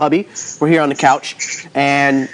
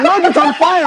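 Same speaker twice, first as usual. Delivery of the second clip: laughing quote; 12 semitones higher than usual. Retold or imitated. Retelling Gary's excited fire text.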